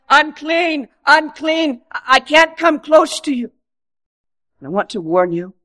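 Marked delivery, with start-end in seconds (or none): 0.1-3.5 raised, calling cry of a leper. Retold or imitated. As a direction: warn people off from a distance. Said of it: "the leper who would have to go around saying".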